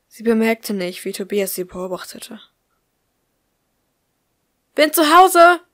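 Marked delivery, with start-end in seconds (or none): 4.7-5.6 loud call down the hallway. A narrator's reading. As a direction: shout it into the house from the door. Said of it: "rief sie". (laut in den Hausflur gerufen)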